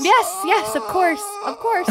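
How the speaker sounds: high, squeaky eager agreement; forced enthusiasm. Acted. Chalice reassuring Jalbert the answer's yes, over-eager. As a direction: insist through a big put-on smile. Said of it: "musters up a big smile".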